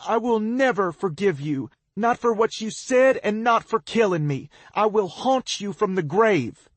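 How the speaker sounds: high, loud furious threat; injured girl shouting threats up at him; angry shout. A narrator's reading. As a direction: hurl the curse at him. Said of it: "she shouts".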